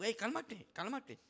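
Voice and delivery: high firm calming call; calming him down. Retold or imitated. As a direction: settle him firmly. Calming a panicking coworker. (llamado firme para calmar)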